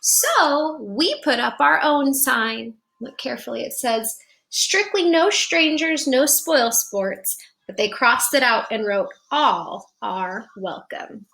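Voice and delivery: put-on bright storybook narration for kids; gentle picture-book reading voice; reading to children. A narrator's reading. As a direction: read it like storytime on the rug. Reads picture book aloud.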